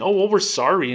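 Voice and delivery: hammed-up corporate sorry; mock fakeness. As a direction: mimic an insincere bank apology. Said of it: "That fakeness that Bank of America has saying".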